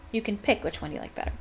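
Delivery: ordinary delivery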